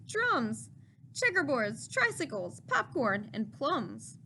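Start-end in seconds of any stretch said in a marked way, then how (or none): none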